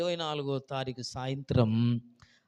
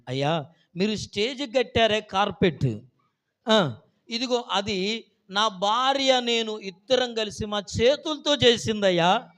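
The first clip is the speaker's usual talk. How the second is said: a hint of a weeping old man, higher and louder; emotional old man; 8 semitones higher, 6 dB louder than usual. Old husband telling the pastor about the carpet he made, tearful and slow. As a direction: plead slowly, moved.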